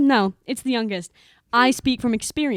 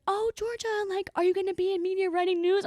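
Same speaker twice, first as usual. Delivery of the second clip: high, mock-eager quote of the classmates; teasing impression of nosy classmates; 8 semitones higher, 4 dB quieter than usual. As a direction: mimic everyone's prying question, pitched high. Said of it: "everyone was like".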